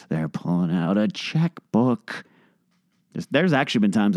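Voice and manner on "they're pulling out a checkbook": exasperated sigh. Real, not acted. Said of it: "the smoke starts to rise".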